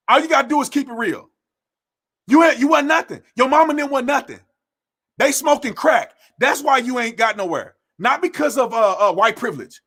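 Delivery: ordinary talk, nothing marked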